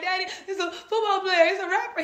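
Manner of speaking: high, whiny mock-tearful explaining; hammed-up whimpering; imitated crying. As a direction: explain to dad through pretend tears. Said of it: "I'm crying".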